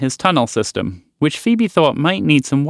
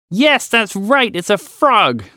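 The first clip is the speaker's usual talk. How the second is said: shouting; 8 semitones higher, slower than usual. A narrator's reading. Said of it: "the frog shouted".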